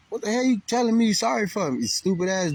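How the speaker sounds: high, exasperated mock rant; imagined angry retort. What he almost told the driver, high exasperated retort. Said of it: "'Cause I almost told him".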